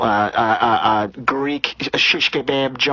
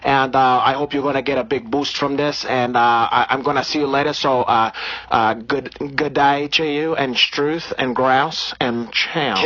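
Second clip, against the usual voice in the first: put-on Brazilian accent. Comedian holding a put-on Brazilian accent.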